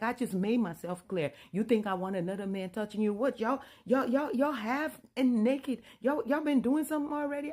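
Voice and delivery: loud and high, outraged; furious accusation. Retold or imitated. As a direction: accuse them, losing it. Husband furious at half-naked pair.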